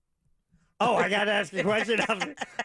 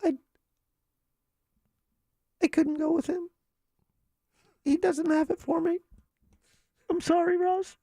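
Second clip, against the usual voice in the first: breathy high starlet voice, much higher; high, breathy and wounded; Marilyn Monroe; 10 semitones higher than usual. Pretend. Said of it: "Miss Marilyn Monroe".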